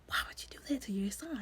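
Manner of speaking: fast, hushed disbelief; appalled whisper. Retold or imitated. Viewer asking the father in a shocked whisper. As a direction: breathe it out in shock.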